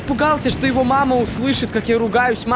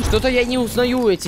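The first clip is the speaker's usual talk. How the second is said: normal talking voice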